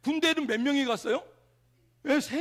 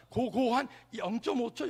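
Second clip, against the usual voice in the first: ordinary talk, nothing marked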